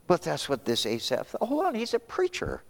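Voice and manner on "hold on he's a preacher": high-pitched protesting objector; objecting. Acted. Preacher acting an objector about a preacher, high-pitched protest.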